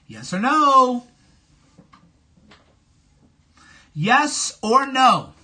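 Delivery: loud, hard demand; pressing a caller for an answer, loud and demanding; demanding yes or no. Real, not acted. Streamer demanding a yes or no from caller, loudly.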